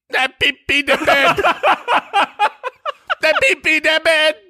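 put-on high little kid voice, laughing; squeaky toddler confessing; peeping baby chick. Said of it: "I was like".

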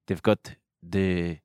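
slow, lisping Israeli accent, lightly done. Shown as a demonstration. Friend shows cohost how Israelis talk, lisping Israeli accent. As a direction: ease into a mock Israeli accent with a lisp. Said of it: "They talk like this".

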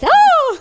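loud, high-pitched "oh!"; imitated cry of "oh". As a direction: shoot way up into a mock outcry. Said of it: "That's literally how I went".

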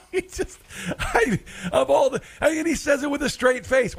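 high, laughing and half-shouting; can barely get it out for laughing; laughing in disbelief. Real, not acted. Host mocking John Kerry's climate remark, laughing.

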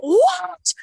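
high-pitched, loud, hammed-up disbelief; exaggerated shocked protest, voice shooting way up; very animated mom. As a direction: mimic an animated mom shrieking in alarm, not angry. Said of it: "she's very animated".

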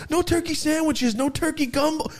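very high, heightened movie quote; Christmas Story lament. Acted. Quoting the Christmas Story's lost turkey, high-pitched lament. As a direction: wail it like a dramatic narrator. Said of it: "Whatever he says on the Christmas Story".

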